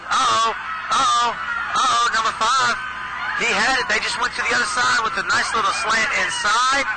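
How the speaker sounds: rising to a real shout as he scores; excited call. Real, not acted. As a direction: call the touchdown like you can't contain it. Touchdown pass, live call.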